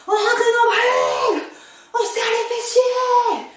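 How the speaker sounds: loud, slow, laughing coach shout. Real, not acted. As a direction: bellow the cues while laughing. Coach yelling safety cues.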